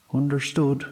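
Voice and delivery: quick obedient promise; bright child's answer; girl's voice. A narrator's reading. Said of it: "Grania said".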